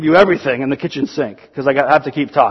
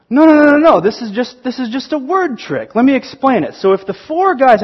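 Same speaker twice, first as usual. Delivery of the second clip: eager correction; 6 semitones higher than usual. Retold or imitated. Math counselor to angry kid, eager and high. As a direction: jump in eagerly to set the kid straight.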